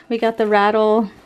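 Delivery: normal talking voice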